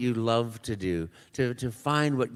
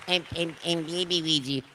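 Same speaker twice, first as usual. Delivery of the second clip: put-on baby Luigi voice; 6 semitones higher than usual. Baby character voice.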